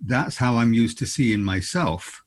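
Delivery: ordinary talk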